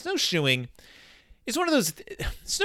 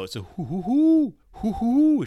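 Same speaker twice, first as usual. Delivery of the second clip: owl hoots; 9 semitones higher, 4 dB louder than usual. Retold or imitated. Sound coming from a tree.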